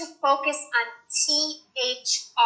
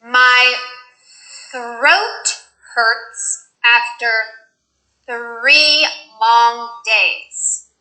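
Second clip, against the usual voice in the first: slow thr practice; much slower than usual. Shown as a demonstration.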